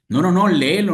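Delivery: mock-shout the crowd's demand; crowd clamoring. Crowd demanding will be read.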